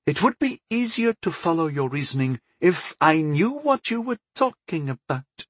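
high-voiced goddess, gently reproving; goddess, mildly impatient. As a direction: pitch it up as a puzzled, impatient goddess.

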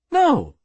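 urgent, high-pitched protest; insistent. A narrator's reading. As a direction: deny it emphatically. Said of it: "insisted Burnham".

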